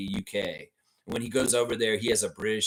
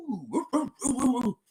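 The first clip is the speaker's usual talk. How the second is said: very high "woo woo" hoots; rapid falsetto-like whoops; playful whooping; 12 semitones higher than usual. Pretend. Host hyping a rookie to fans, playful whooping. Hooting after the nickname chant.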